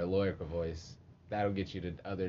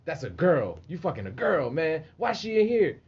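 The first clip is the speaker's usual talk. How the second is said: higher, louder, mounting disbelief; mock incredulous; 6 semitones higher, 7 dB louder than usual. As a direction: challenge him in playful disbelief, getting higher. Retold confrontation in jail clip.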